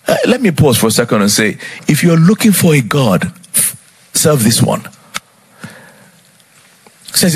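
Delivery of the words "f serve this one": ordinary talk, nothing marked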